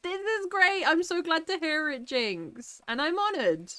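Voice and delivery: high, emotional gushing; overflowing with gratitude; touched and thrilled. Real, not acted. Thanking a viewer who credits her, high and moved.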